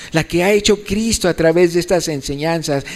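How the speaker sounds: normal talking voice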